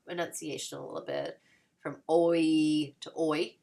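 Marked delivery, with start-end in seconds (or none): 1.8-3.6 first oi slow and drawn out, second oi quick; long then quick oi. Shown as a demonstration. Teacher contrasting old and modern oi, slow then fast. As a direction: stretch the first oi, snap the second. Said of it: "really quick, a little bit quicker sound".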